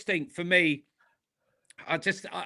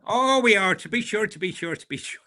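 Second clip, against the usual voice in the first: mock Irish accent; 2 semitones higher than usual. Joining the friend's running bit, hammed-up Irish that fades. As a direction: play up a stage Irish "to be sure". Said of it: "we're doing it again".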